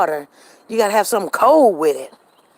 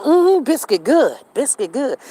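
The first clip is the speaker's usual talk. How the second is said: imitating husband; 6 semitones higher, 3 dB louder than usual. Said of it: "he said".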